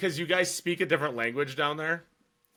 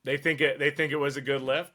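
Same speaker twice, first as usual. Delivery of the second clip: usual speaking voice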